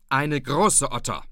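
loud, scoffing retort; old man huffing back at his daughter; snorting retort. A narrator's reading. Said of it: "rief der Alte schnaufend".

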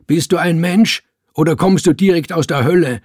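ordinary delivery, nothing marked

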